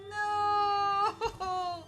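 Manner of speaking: laughing scream. Real, not acted. Laughing scream during game.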